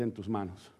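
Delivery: quiet prayer. Retold or imitated. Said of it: "le dice".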